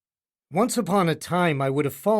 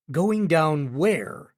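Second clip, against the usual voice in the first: usual speaking voice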